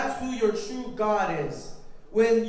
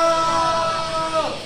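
long, high whoa falling away; time-travel whoa; 5 semitones higher, 4 dB louder than usual. Pretend. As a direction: hold a loud whoa through the pretend ride. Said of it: "you're gonna go like this".

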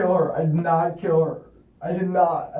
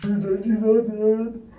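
usual speaking voice

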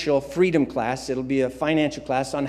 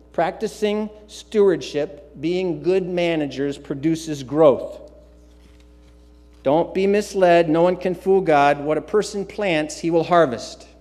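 usual speaking voice

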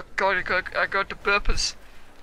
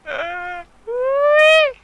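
gleeful whee; 11 semitones higher, 6 dB louder, much slower than usual. Real, not acted. Playful game cheer.

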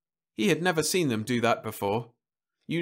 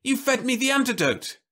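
much higher, accusing cry; high outcry; 13 semitones higher, 3 dB louder than usual. A narrator's reading. Man accusing his wife of drugging him, crying out. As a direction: shriek the betrayal. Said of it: "he cried".

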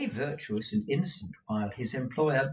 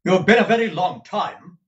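louder, sharp rebuke; stern Ralph; 8 semitones higher, 12 dB louder than usual. Acted. Employer scolding his clerk for lateness, loud. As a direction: scold him for dawdling.

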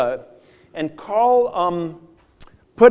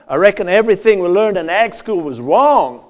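best attempt at a Southern accent, louder; put-on Southern accent, firmly voiced; Sonny Price; 5 dB louder than usual. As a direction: imitate a Southern farmer friend's accent, projecting it. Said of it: "do my best Southern accent".

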